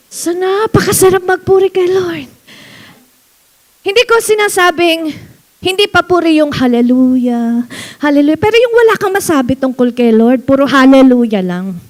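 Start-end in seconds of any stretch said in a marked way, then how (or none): none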